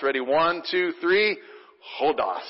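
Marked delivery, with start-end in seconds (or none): 2.0-2.4 "Hodos" spoken in unison, falling; saying hodos together. Shown as a demonstration. Pastor leading the congregation in saying "Hodos". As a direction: chant "Hodos" with the room. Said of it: "say it one more time".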